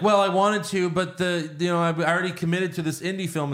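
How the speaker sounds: sing-song insincere excuse; mock excuse. Mocking the actress turning down the film, high and hurried.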